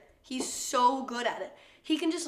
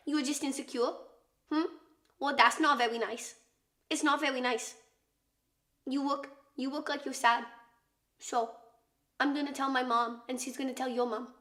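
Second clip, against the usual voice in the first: imitated little sister. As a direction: mimic a confident kid with a speech impediment. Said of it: "She can't say her R's".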